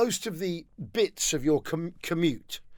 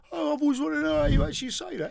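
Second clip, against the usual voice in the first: high, softly marvelling mock voice; wondering listener; 8 semitones higher, 4 dB quieter than usual. Imagined listener learning the pronunciation, high mock wonder. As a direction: coo it high, as if enlightened.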